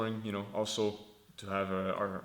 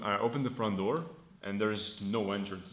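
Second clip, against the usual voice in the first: ordinary talk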